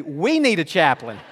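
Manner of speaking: mock desperate plea. Son wishing he could tell the nurse, mock desperate and loud. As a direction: beg as if overwhelmed, stretched out. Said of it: "I wanted to say".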